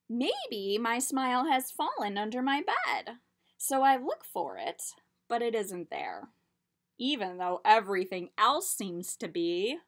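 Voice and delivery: lively storybook read-aloud; reading to children. A narrator's reading. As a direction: tell it like a picture book. Reads picture book aloud.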